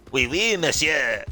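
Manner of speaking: high, loud, slow mock French accent; exaggerated French accent. Shown as a demonstration. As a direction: ham up a cartoon French accent. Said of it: "me doing an exaggerated French accent".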